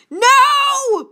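high, loud, drawn-out cry; dramatic high-pitched scream; cried out. A narrator's reading. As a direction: wail it in acted anguish. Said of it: "Piper cried".

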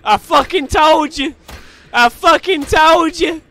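high, loud gloating yell; triumphant shouting. Real, not acted. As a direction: scream it in victory, slow and loud.

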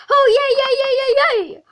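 high, loud gleeful yays; squealing delight. Acted. Joker thrilled someone wants a joke, high and loud. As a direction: squeal with glee.

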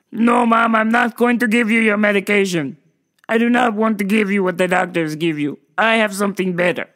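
stiff, stubborn son caricature; odd put-on voice, firmly evenly pitched; Doctor Octopus. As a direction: refuse mother in a comic accented monotone. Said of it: "I talk like this because I'm Doctor Octopus".

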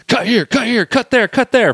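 high, loud rapid commands; barking director. Retold or imitated. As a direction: call the shots like a live director. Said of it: "he's like".

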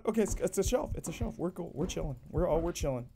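soft, low relieved self-talk; calming self. Real, not acted. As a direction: quietly talk yourself down after a fright. Calming self after false alarm.

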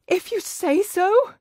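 high, slow, breathless and shaken; gasped. Acted. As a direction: still scared, barely convinced. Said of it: "she gasped".

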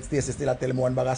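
normal talking voice

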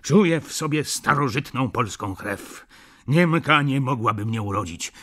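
loud, proud declaration; tipsy boasting yell. A narrator's reading. Jan after one glass of wine, banging the table, yelling. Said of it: "i wrzeszczeć".

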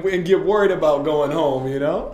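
loud, high, laughing pep-talk voice; fired-up coach. Retold or imitated. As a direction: shout it like a coach firing up his team, half laughing.

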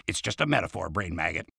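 hushed reassurance to the bug; quiet aside. Acted. Aside to the brain maggot.

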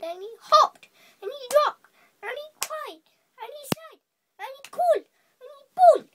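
high, excited chant building to a loud bawl; escalating high-pitched list; mounting frenzy. A narrator's reading. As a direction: punch each verb high, loudest on the last. Frantic list of mariner's antics.

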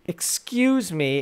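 ordinary talk

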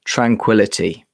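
Tranquility. said low and calm with a falling tune; tranquil read. Shown as a demonstration. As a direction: say it like the name of a soothing tea.

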